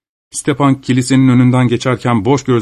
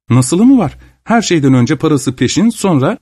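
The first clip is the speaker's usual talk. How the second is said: lightly voiced lady, pitched higher; a hint of an imperious mistress, voice raised in pitch; haughty lady; 6 semitones higher than usual. A narrator's reading. Landowner lady demanding money from a peasant, lightly voiced haughty.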